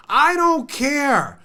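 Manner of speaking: slow, high-pitched impatience; exasperated dismissal. Real, not acted. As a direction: brush off the objection, slowly and emphatically. Rebuts an imagined objection.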